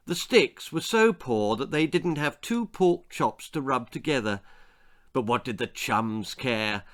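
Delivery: usual speaking voice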